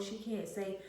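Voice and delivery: usual speaking voice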